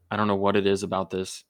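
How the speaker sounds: ordinary talk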